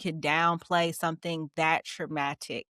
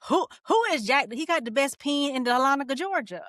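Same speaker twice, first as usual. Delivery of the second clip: high-pitched mock-scared questioning; spooked reaction; 7 semitones higher than usual. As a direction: act out a startled, high-voiced reaction. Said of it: "That scared the shit out of me".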